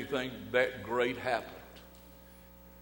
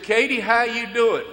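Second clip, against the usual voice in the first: bright, higher, louder hello through the window; cheerful greeting; 10 semitones higher, 10 dB louder than usual. Retold or imitated. Preacher greeting the teller at the drive-through, cheerful and loud. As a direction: greet her like a happy regular.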